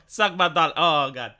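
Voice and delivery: usual speaking voice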